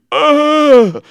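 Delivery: loud, high mock group gasp; one big exaggerated outburst; imitated crowd gasp. Imitating a whole student body, loudly amazed. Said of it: "The whole student body's going".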